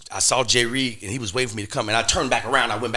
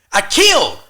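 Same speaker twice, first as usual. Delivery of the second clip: "I killed" said higher and louder, stress on killed; loud insistence; 9 semitones higher, 10 dB louder than usual. Retold or imitated. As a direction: punch "killed" hard and high. Insisting he did well.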